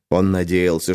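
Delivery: plain reading voice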